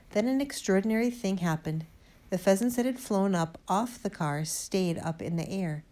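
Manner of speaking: plain reading voice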